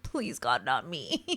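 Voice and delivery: low, soft pleading murmur to God; hushed prayer. Retold or imitated. Stranded at the gate, quietly begging God. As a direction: beg quietly under your breath. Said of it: "And I was like".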